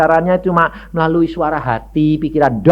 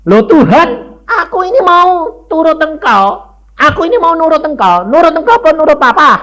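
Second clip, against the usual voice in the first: high, loud pleading to God; desperate plea; 7 dB louder than usual. Retold or imitated. Young preacher begging God for an answer, high and loud. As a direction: demand an answer, near shouting.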